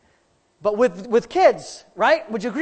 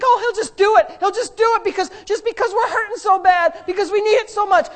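high, loud mock naive voice; lightly voiced naive believer; 10 semitones higher, 4 dB louder than usual. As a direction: play the naive believer's hope. Voicing believers' naive thinking.

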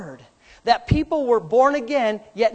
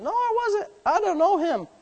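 mock denial; 9 semitones higher than usual. Peter denying Jesus to a little girl, mock high voice. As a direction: play a disowning disciple, pitched up.

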